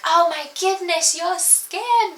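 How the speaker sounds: high, amazed gasp of praise; mock-amazed friends; friends gushing. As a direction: gush like an impressed friend. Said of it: "they were like".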